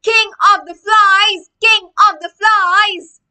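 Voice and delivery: high, loud, slow jeering yell; yelled taunt. Acted. Kid yells at Greg with the flies, loud slow jeer. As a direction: yell it across the street to mock him. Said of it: "Someone else yelled out".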